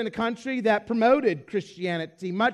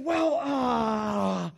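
awkward hesitation. Retold or imitated. Preacher cornered by his seatmate, awkwardly stalling. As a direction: imitate someone stuck for an answer. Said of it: "you're not sure what to say".

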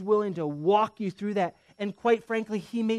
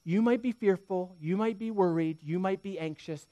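ordinary talk

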